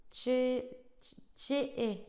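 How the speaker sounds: tché, tché-é, le tch étiré; sound tché. Shown as a demonstration. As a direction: articule bien le tché. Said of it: "Un truc dans le genre". (prononce tché, tché-é en traînant la fin)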